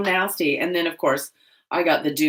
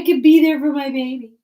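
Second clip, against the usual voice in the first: higher, louder and fast, doting; 9 semitones higher, 8 dB louder, faster than usual. Real, not acted. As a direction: squeal it like a proud horse mom. Doting on her horse.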